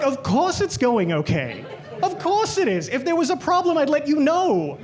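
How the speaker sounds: high, bright, overly reassuring; mock chirpy service rep. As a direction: play the too-eager salesperson with a laugh.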